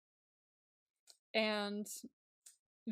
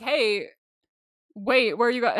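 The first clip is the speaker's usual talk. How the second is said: louder, acted calling after someone; 8 dB louder than usual. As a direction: call out as if stopping someone leaving. Acted quote, calling after one leaving.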